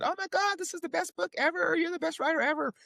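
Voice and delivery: high-pitched over-the-top gushing; gushing praise. Retold or imitated. Publisher raving to a new author, gushing.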